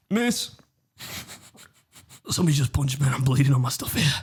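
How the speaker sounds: high, pleading schoolboy cry to the teacher, in a put-on American accent; whiny dramatic plea. Bleeding kid calling to the teacher, high and dramatic in a put-on American accent. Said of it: "can you do the accent again?".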